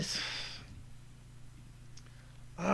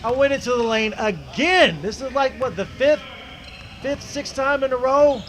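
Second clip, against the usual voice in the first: louder, higher, insistent recap; emphatic incredulity; 4 semitones higher, 6 dB louder than usual. Real, not acted. Player reviewing his fifth drive into the lane, emphatically incredulous. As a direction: drive it home, voice climbing in disbelief.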